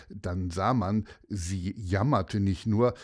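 plain reading voice

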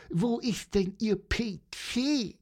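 high bird voice with an impatient groan. Acted. Little bird groaning to policeman about the typewriter, high impatient voice. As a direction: moan at the slowness, chirpy and high. Pieper's voice continues.

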